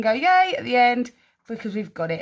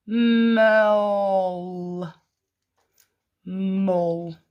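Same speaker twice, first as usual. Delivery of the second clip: "Mull" stretched out with a long humming m; "Mull" in slow motion; slowed like a snail. Shown as a demonstration. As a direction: draw "Mull" right out, sound by sound. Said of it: "Let's slow it down like a snail".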